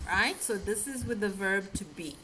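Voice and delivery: ordinary delivery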